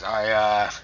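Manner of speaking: ordinary talk, nothing marked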